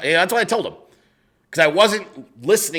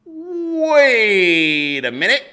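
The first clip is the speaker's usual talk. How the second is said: very slow, drawn out and higher, a dawning realization; slow, higher, drawn-out retold realization; 7 semitones higher, 4 dB louder, much slower than usual. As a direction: stretch it out as it sinks in. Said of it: "the moment that I was like".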